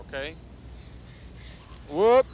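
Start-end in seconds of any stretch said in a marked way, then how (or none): 1.9-2.2 playful whoop. Real, not acted. Playing with child in field.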